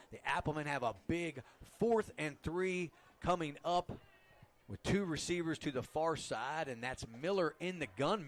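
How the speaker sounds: ordinary delivery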